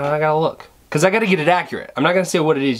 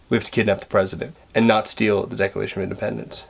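terrible Nicolas Cage impression; 9 semitones lower, 4 dB quieter, much faster than usual. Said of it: "That's a terrible Nicolas Cage impression".

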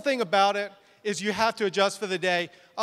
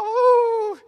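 very high, loud mock squeal; freak-out squeal; 14 semitones higher, 6 dB louder than usual. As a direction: squeal in hammed-up hysteria. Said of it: "I'm freaking out".

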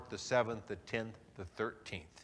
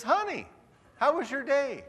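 sweet spouse greeting; 12 semitones higher, 5 dB louder than usual. Retold or imitated. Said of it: "and says".

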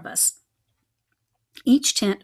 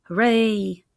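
yell; 5 dB louder than usual. A narrator's reading. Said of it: "yelled the little mice".

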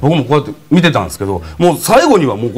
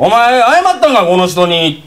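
兄貴の叱りつけ; 6 semitones higher, slower than usual. Retold or imitated. 兄貴店長の口真似.

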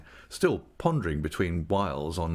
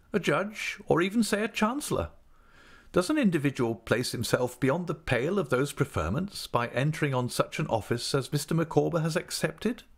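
higher, weighty lady's question; profound air; 9 semitones higher than usual. Acted. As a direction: pose a lofty question, pitched up. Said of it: "assuming a profound air".

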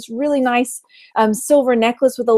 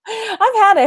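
mock rant; 10 semitones higher, slower than usual. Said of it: "ranty time".

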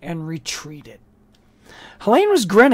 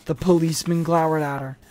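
plain reading voice

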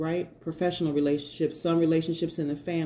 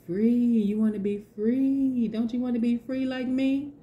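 lilting, higher bird voice, slowing down; a sing-song bird calling you to freedom; coaxing bird voice; 5 semitones higher, slower than usual. Retold or imitated. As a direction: play a bird tempting someone to fly free. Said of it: "The bird is like".

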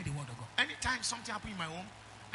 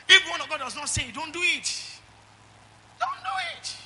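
loud, high preaching cry; shouted sermon warning; 7 semitones higher, 9 dB louder than usual. Real, not acted. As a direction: drive the command home full force. Preaching climax.

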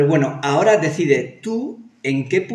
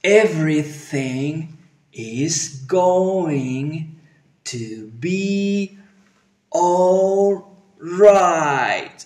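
slow motion; 3 semitones higher, much slower than usual. Shown as a demonstration. Teacher repeats with clown-nose boldness, slow motion. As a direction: drag every vowel out boldly, no fear of ridicule. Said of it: "lo voy a hacer otra vez".

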